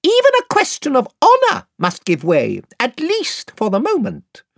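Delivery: very high, piping, courtly little voice; shrill piping mouse. Acted. Reepicheep greeting Queen Lucy in a piping voice. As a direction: pipe it like a tiny gallant knight.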